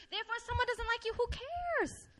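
quick, high-pitched shrug-off; breezy dismissal. Real, not acted. Preacher reassuring the disliked, high breezy dismissal. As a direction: rush the first part, then toss off "who cares".

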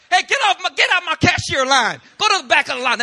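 high, loud yelling at a customer; angry cashier yell. Retold or imitated. Walmart cashier yelling at a rude customer, high and loud. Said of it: "they're gonna be like".

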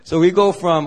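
normal talking voice

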